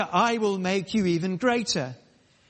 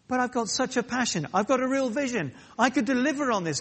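high, fast eager plea; pitched-up eager protest; eager pleading; 8 semitones higher, faster than usual. Pretend. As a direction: gush like a hopeful David. David pleading with God.

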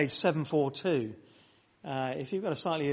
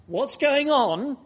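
put-on higher, louder and slow, like a curious child; child asking; 8 semitones higher, 7 dB louder, slower than usual. Preacher playing a child asking parents, high and slow. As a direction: ask it as a wide-eyed little kid. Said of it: "a child in the family asks".

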